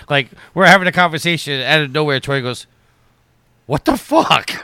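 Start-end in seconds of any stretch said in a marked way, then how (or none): 3.7-4.5 high, loud imitation of shock; startled outburst. Imitating a friend interrupting the conversation, startled. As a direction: blurt it out of nowhere, mock-shocked.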